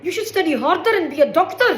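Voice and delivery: loud, high-pitched nagging Indian parent. Acted. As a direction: push the child loudly, as an Indian parent. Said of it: "Voice of Indian parenting".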